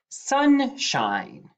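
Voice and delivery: Sunshine said on a high rising pitch; high announced word. Shown as a demonstration. Teacher announcing the dictation word high and bright. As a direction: sing out Sunshine high to start. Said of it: "Number three".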